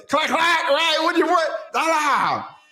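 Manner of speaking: hammed-up hype. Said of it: "Watch this".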